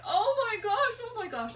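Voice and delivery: high, loud tearful cry, falling away; tearful panic. Retold or imitated. Mom retelling her scare, in tears. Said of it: "I was in tears".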